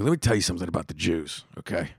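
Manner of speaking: conspiratorial aside. Retold or imitated. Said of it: "at the point of no return".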